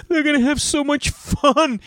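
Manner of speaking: very high, slow whiny mock sobbing; exaggerated child's tearful whine; imitated crying son. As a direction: wail it like an upset little boy. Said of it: "So then he starts crying".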